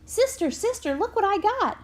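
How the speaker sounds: put-on high, excited child calling out; boy bursting to show his prize, pitched high; eager child. A narrator's reading. Boy runs to show his teacher the watch, high and eager.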